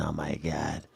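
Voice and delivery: breathy whispered awe. Retold or imitated. End of impression.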